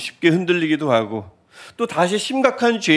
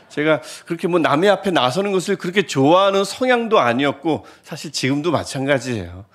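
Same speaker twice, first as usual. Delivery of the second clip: normal talking voice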